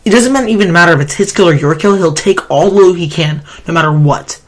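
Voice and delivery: ordinary delivery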